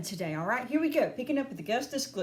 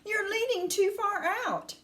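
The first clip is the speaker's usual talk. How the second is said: high-pitched anxious call; high, worried warning; shouted warning; 10 semitones higher than usual. A narrator's reading. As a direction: warn him urgently, pitch raised. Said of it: "shouted Mister Gloop".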